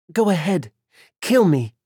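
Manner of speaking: loud, high mocking dare; a captive daring her attacker to kill her; taunting dare. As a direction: goad him to do it. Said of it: "Clearly furious at her taunt".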